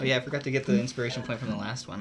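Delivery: normal talking voice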